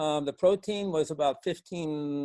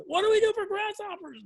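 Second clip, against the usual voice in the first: high, panicky plea for help; panicking; 3 dB louder than usual. Retold or imitated. Phoning organic friends about the grasshoppers, panicked. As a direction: replay your own near-panic, pitched way up. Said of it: "almost panicking".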